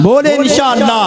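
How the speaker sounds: high-pitched shouted prompt to the crowd; rousing call. Real, not acted. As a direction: call it out high to rouse a response. Repeats call for louder response.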